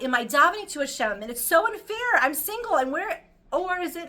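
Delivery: high, loud whiny grievance; mock complaint. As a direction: play the hard-done-by single woman.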